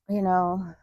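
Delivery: ordinary talk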